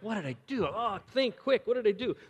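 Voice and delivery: high, hammed-up panicky voice; mock panic. Man facing a crying woman, comically panicked. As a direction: play a man frantically racking his brain. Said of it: "we kinda just naturally panic".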